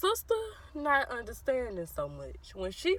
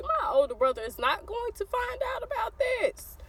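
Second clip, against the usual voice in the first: insistent denial; 11 semitones higher than usual. Retold or imitated. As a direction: insist it in a high, singsong voice. Confident thought, acted out emphatically.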